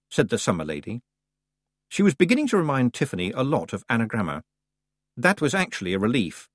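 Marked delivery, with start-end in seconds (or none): none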